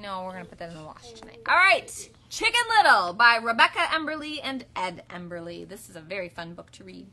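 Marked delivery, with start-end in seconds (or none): 1.5-2.1 loud call. Real, not acted. Calling start of story. 2.3-5.7 title announcement. Acted.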